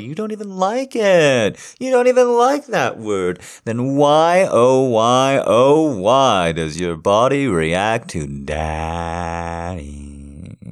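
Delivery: taunting. Acted. Taunting the listener about daddy.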